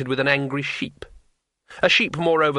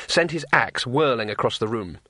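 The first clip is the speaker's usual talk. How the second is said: ordinary delivery, nothing marked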